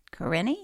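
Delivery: Corinne as a questioning kuh-RIN-ee; sounded final E. Shown as a demonstration. Reader unsure of a name, Corinne with rising EE. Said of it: "pronounced the E at the end".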